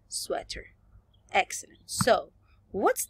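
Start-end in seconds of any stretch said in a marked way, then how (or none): 0.1-0.8 "sweater" said the English way, with English r; correct English pronunciation. Shown as a demonstration. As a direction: model "sweater" carefully as an English word. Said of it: "la correcta pronunciación es".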